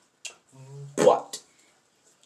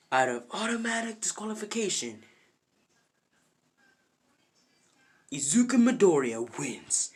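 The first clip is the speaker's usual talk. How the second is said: raised announcer voice; match announcer; 6 semitones higher than usual. Retold or imitated. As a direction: declare the winner to the arena. Match announcer declares the winner.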